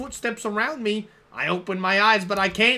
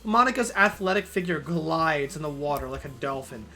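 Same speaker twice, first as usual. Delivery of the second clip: ordinary talk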